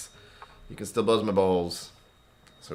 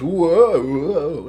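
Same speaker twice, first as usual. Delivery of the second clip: mock whoa; 8 semitones higher, 11 dB louder than usual. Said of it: "everything just goes".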